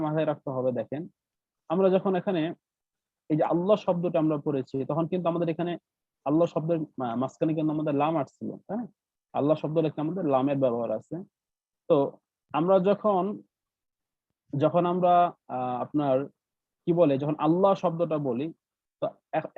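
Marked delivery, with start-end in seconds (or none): none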